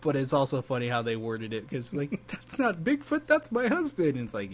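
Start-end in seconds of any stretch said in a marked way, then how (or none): 2.3-4.2 quoted line pitched way up; squeaky put-on voice; high mock voice. Quoting a show line.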